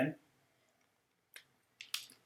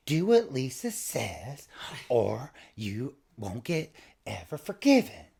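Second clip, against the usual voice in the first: mock Lisa warning; 4 dB louder, slower than usual. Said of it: "she's like".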